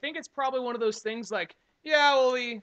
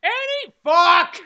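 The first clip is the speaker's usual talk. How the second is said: mock yell; 9 semitones higher, 14 dB louder, much slower than usual. Voicing angry poor kid.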